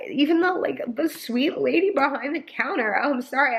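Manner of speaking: pushing through rising tears; choked up telling the grocery story; emotional. Real, not acted. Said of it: "I'm so emotional today".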